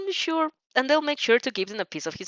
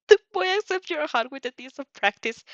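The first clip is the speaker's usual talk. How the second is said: plain reading voice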